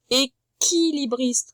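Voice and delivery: Équilibriste said very slowly, syllable by syllable; slow sounding out. Shown as a demonstration. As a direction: stretch out every sound of Équilibriste. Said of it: "comme dans équilibre". (Équilibriste, très lentement, syllabe par syllabe)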